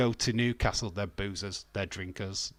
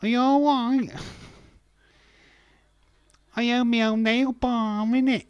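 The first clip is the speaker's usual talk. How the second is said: put-on Essex accent; 13 semitones higher, 8 dB louder than usual. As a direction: squeal it like a TOWIE girl. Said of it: "The only way is Essex".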